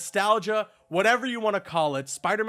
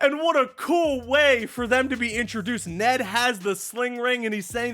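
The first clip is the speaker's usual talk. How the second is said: loud, high, thrilled yelling; excited shout; 6 semitones higher, 7 dB louder than usual. Real, not acted. Reviewer telling viewers about the cameo, thrilled shouting. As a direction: shout the news like you can't contain it.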